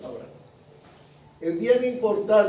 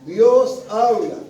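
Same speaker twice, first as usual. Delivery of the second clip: DIOS HABLA slow, loud, each word stretched; emphatic declaration; 2 semitones higher, 7 dB louder, much slower than usual. Real, not acted. Preacher telling the congregation that Dios habla, emphatic declaration. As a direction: punch "Dios habla" loudly, drawing out both words. Preacher drives home key point.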